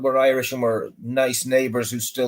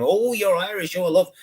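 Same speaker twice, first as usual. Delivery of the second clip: gushing woman; 7 semitones higher than usual. Retold or imitated. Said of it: "some lass or a few of them".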